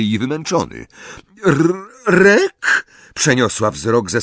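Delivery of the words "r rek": high, stammered question; loud, high, bewildered stutter; stunned. A narrator's reading. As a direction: falter on the name in disbelief. Wife stunned by second husband.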